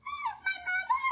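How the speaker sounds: put-on high, small baby bird voice. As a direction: ask like a tiny lost chick. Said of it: "he said".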